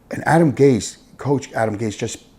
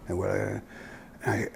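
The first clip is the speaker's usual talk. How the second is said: ordinary talk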